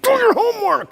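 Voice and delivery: sudden very high yelled order; exasperated parent. Retold or imitated. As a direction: snap at the kid to get going. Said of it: "and you're like".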